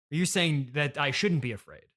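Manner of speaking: normal talking voice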